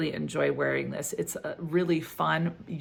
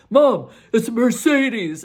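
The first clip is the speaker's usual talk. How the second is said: put-on her kid's voice, pitched up and louder; voicing her son; 6 semitones higher, 10 dB louder than usual. Son answering mom about the G-Wagon, louder and higher. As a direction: play the son, bright and a bit exasperated. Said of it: "He's like".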